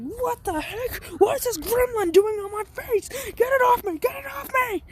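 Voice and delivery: very high, squealing panic; panicked squeal. Retold or imitated. Bakugo with a robot on his face, squealing in panic. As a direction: shriek like a kid with a gremlin on his face. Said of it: "Bakugo's like".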